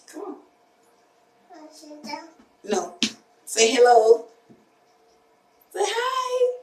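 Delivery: very high, soft singsong. Real, not acted. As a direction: coax a shy little one sweetly. Coaxing shy child to greet viewers.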